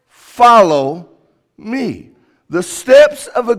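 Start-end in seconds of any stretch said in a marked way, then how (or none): 0.1-2.1 emphatic call. Acted. Preacher repeats Jesus' call.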